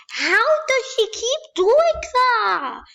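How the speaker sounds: high, loud frustrated outcry; high-pitched exasperated yell; exasperated shout. Real, not acted. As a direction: yell it in disbelief at her. Frustrated outburst at opponent.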